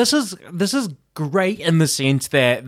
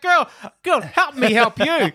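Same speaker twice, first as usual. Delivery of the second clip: pleading; 10 semitones higher than usual. Retold or imitated. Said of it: "I'm like".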